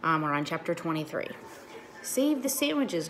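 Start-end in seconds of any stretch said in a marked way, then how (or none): 2.0-3.1 high, slow call over the rain; mild read-aloud yell. A narrator's reading. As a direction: call out to save the food. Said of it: "Gloria yelled to me".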